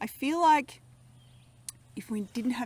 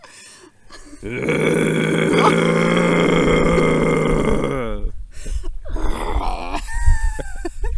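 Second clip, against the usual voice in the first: long loud camel bellow; camel groan; 7 semitones lower, 10 dB louder than usual. Real, not acted. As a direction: bray like a camel. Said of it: "Who can do the best camel sound".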